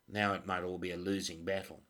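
usual speaking voice

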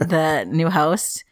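normal talking voice

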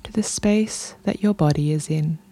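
unhurried meditation voice with long pauses; guided meditation. Real, not acted. Podcast host guiding listeners into their bodies, calm meditation delivery. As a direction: guide them gently through breath and body, leaving space between phrases.

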